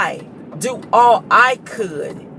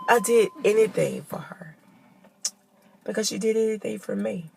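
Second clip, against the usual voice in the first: real, softer whisper trailing away; 7 dB quieter than usual. Real, not acted. Remembering all she did for grandma, real soft whisper. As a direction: let it drop to a whisper, overcome. Tearful memory of her grandma.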